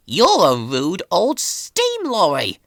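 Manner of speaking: slow, offended retort; Thomas, stung and indignant; sharp reply. A narrator's reading. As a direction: snap back at the rude lorry. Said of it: "he replied sharply".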